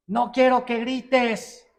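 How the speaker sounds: slow, loud and high mock shout; yelled no shouting. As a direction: yell the ban on yelling. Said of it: "te ve a ti gritando".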